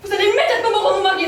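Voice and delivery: fast, loud furious demand; angry demand. Acted. Wife demanding her husband back from the assistant, furious. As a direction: rattle off the demand in a rage.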